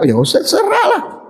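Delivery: high pitched mock plea; mock pleading voice. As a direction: squeak it out like a nagging follower. Mocking the critics' advice. (suara memelas pura-pura)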